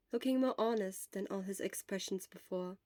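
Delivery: usual speaking voice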